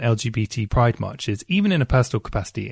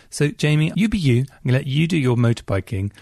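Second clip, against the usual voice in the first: ordinary talk, nothing marked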